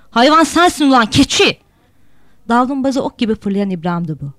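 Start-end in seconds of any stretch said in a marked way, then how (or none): 0.1-1.6 loud, higher furious retort; angry retort shout. Acted. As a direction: throw the insult right back. İbrahim shouts back. (öfkeli karşılık bağırışı)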